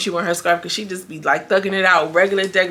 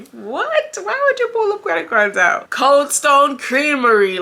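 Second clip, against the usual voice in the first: high, loud disbelief with a laugh; incredulous laughing; 8 semitones higher, 4 dB louder, slower than usual. Real, not acted. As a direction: laugh out in shock. Incredulous reaction to credit cards.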